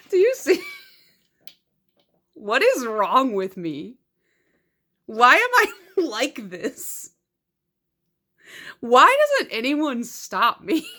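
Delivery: loud, high, laughing self-exasperation; mock despair at her own habit; exasperated at herself. Real, not acted. Mock despair over buying duplicates.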